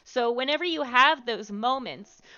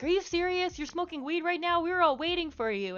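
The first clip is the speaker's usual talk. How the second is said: higher-pitched, indignant scolding; imitating someone fed up with waiting; mock angry cousin; 9 semitones higher than usual. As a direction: act out her exasperated telling-off. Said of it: "she got mad".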